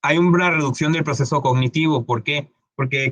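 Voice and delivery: ordinary talk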